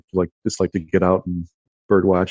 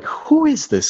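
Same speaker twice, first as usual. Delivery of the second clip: baffled question; slower than usual. Retold or imitated. Boy puzzled by strange woman.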